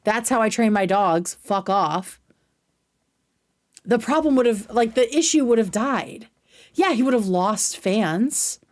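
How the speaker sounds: ordinary delivery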